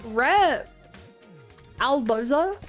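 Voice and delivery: ordinary talk, nothing marked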